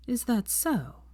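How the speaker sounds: a cold society lady's reply; cool haughty lady. A narrator's reading. Narcissa voiced.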